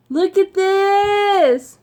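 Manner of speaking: high, drawn-out excited shout; real overjoyed outburst; thrilled. Real, not acted. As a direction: squeal it, stretching the words out. Thrilled at unboxed gift.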